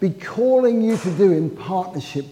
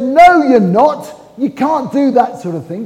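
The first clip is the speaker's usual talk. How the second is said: indignant protest; 6 semitones higher, 8 dB louder than usual. Acted. Peter loudly refusing to let Jesus die. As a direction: throw it back at him in outrage.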